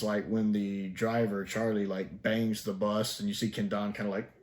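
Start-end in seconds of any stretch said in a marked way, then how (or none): none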